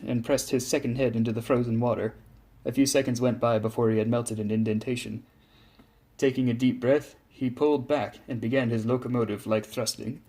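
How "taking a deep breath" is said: plain reading voice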